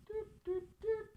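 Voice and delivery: high playful doots; calculator doot sounds. Pretend. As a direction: chirp them out like a machine. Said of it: "Do you like my sound effects?".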